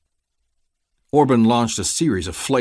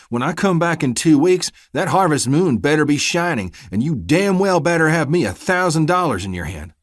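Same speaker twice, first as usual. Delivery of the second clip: loud, raised warning; menacing threat; 9 semitones higher, 6 dB louder than usual. Acted. Deputy setting the payment deadline, menacing. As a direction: lay down the ultimatum.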